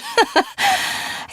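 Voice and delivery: sudden high-pitched villain giggle; high gleeful villain titter. Acted. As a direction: let a shrill giggle escape. Villain's weapon fires.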